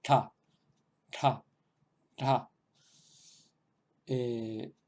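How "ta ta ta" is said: "Ta" voiced with breath, like "dha"; breathy voiced retroflex "Ta"; voiced aspirated retroflex. Shown as a demonstration. Teacher demonstrating the voiced aspirated sound for learners. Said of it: "la versión sonora, pero aspirada".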